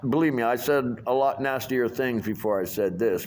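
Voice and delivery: ordinary delivery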